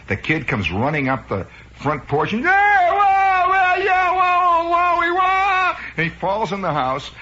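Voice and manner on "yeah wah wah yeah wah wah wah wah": put-on very high, loud kid's wailing; hammed-up howling crybaby, long drawn-out wails; bawling child wail. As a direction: wail like a kid running home in tears. Bawling kid runs up porch.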